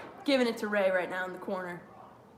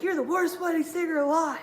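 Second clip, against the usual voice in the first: high, louder heckling jeer; imitated heckler; 7 semitones higher, 4 dB louder than usual. Wedding guest heckles the singer, imitated jeer. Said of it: "the guy's like".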